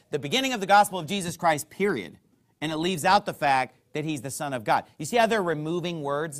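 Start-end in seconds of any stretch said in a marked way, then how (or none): 0.1-2.2 cut-short version, fast. Shown as a demonstration. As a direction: rush it and clip it off at "period.". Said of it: "a new modern version that just says".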